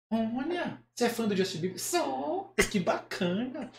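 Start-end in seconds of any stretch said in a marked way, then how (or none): 1.8-2.5 high, soft puppet voice; drawn-out dummy answer; Kleber. Acted. Puppet admitting he is a fan, high puppet voice. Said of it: "acha uma voz que pareça com o boneco".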